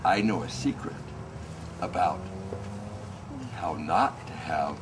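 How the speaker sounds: hushed, low and soft, fading to breath; soft secret. A narrator's reading. Conqueror sharing his secret softly with the princess. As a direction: tell it like a confidence. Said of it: "he whispered in her ear".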